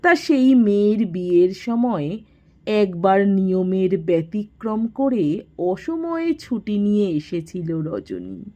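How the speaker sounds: ordinary delivery, nothing marked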